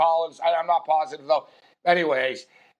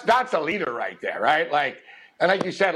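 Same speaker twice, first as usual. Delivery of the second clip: ordinary talk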